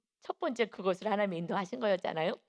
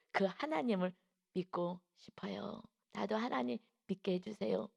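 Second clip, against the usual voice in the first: softly, haltingly sincere; hushed, humble asking; timid earnest request; 7 dB quieter than usual. Retold or imitated. Child asking the teacher for faith, quiet earnest request. Child shyly asks to believe.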